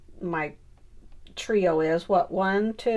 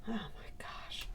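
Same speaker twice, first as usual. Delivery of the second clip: whispered exasperation; 14 dB quieter than usual. Real, not acted. Exasperated at the difficulty.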